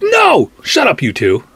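sharp, high retorts to the nagging voices; telling them off. Retold or imitated. Talking back to his own fears, sharply loud. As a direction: shut the two inner voices up, loud and fed up. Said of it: "And I'm like".

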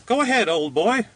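high-pitched, cheery little man; cheerful cry. A narrator's reading. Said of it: "cried the little man cheerfully".

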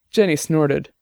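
usual speaking voice

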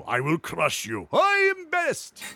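slow brute voice, squeaking up high; barbarian. Acted. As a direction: boast like a dim-witted warrior. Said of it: "they all just get together and fight".

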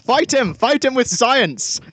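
rally him to fight, hammed-up; mock battle cry. Urging co-host against intruder.

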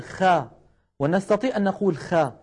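خا thinned, with a light front vowel; light wrong kha. Shown as a demonstration. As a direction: say خا without lifting it to the palate. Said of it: "لكنه ليس هكذا نطق العرب".

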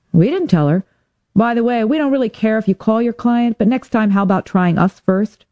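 slightly lifted voice for the cop pal; lightly voiced Julie. A narrator's reading. Julie the cop on the phone, lightly lifted.

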